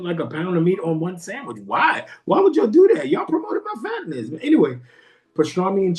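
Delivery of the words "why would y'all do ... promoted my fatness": high-pitched, exasperated complaint; mock protest. Comedian complaining to the sandwich shop, high mock protest. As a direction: squeal it in playful protest.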